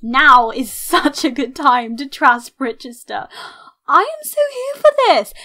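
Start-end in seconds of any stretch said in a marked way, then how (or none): 3.9-5.3 high, loud burst of excitement; thrilled. Real, not acted. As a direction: squeal it with delight. Thrilled at trashing Britchester.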